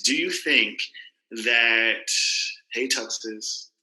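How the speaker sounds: usual speaking voice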